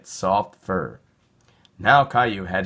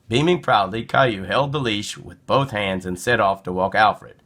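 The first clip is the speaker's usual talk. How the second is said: ordinary delivery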